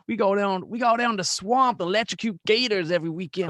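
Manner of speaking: put-on high, loud Southern swamp-country accent. Comic bragging about swamp weekends, hammed-up Southern accent. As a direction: brag loudly in a broad mock Southern accent. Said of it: "when you do a Southern Max accent".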